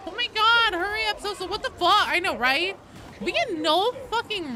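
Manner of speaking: high, loud exasperated squeal; mock outburst. Streamer hurrying Sosa along, high mock outburst.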